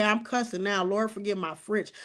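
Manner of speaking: normal talking voice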